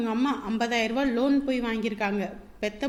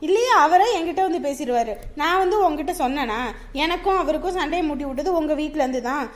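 loud, high, rising fury; heated scolding; 5 semitones higher, 5 dB louder than usual. Acted. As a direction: tear into her without stopping. Sister-in-law quarrel, heated. (கோபமாக திட்டுதல்)

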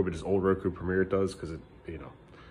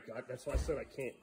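normal talking voice

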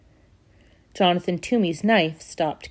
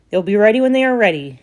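curt, impatient; 3 semitones higher, 7 dB louder, faster than usual. Acted. Grumpy woodcarver brushes off widow.

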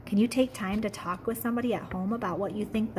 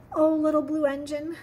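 higher, louder pleading toy voices; stranded toys begging the little engine, higher and louder; dolls and toys crying out; 6 semitones higher, 4 dB louder than usual. Acted. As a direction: call out hopefully to the engine. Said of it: "cried the dolls and toys".

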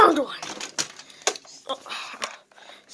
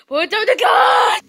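sudden high shout of the command; shouted order; 6 semitones higher, 4 dB louder than usual. Acted. Ordering the frog with a shout. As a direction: yell it at him this time.

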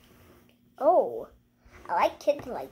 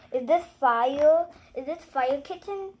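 ordinary talk

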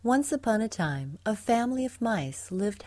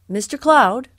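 loud, higher storybook hail; calling out; 5 semitones higher, 12 dB louder than usual. A narrator's reading. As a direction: hail someone high in the sky. Father Mouse hails the cloud.

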